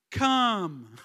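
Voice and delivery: loud demanding call; whiny child demand. Retold or imitated. Child summoning mother, loud whiny demand. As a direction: order mum over, whining. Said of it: "A bit whiny".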